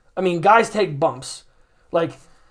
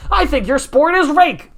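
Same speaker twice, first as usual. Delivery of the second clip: mocking tone; 7 semitones higher, 4 dB louder than usual. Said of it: "you're like".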